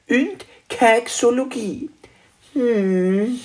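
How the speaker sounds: very high, slow little dragon voice; kleiner Weihnachtsdrache, kleinlaut. Acted. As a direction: confess slowly as a high-voiced young dragon. Story figure: little Christmas dragon. (sehr hohe, langsame Drachenstimme)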